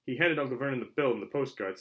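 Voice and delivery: ordinary delivery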